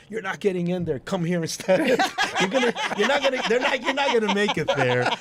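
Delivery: high, laughing mock warning; retold taunt. Friends jeering at the people waiting in line, laughing taunt.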